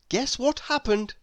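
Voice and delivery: usual speaking voice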